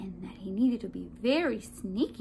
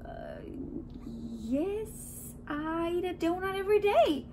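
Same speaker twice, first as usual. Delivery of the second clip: hesitant, higher-voiced sheepish admission; 7 semitones higher than usual. A narrator's reading. Man admits his donut habit, sheepish. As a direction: own up reluctantly, voice creeping up. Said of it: "said sheepishly".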